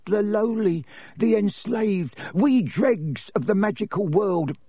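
high-pitched tearful elf lament; sorrowful little house elf, high voice; Dobby the elf, woeful. A narrator's reading. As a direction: plead mournfully in a high servile voice. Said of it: "more tears dripping".

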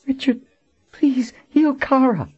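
high woman's voice, begging; pleading woman. Acted. Dying Colin begs Richard to heal Kara.

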